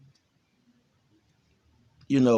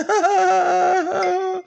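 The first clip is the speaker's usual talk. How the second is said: high squeaky mock laugh, much higher; hammed-up high ha-ha laugh; imitated laughing; 3 dB louder than usual. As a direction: ape a friend's giddy cackle. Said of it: "he was laughing like".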